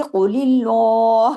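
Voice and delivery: slow recited قول اللہ with a heavy qaf. Shown as a demonstration. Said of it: "اس میں قاف کو heavy".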